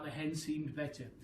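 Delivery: usual speaking voice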